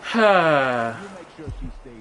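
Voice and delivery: a long, weary exhale; long tired sigh. Real, not acted. Streamer sighing after talking for ages, long tired sigh. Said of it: "that's just me sighing after talking".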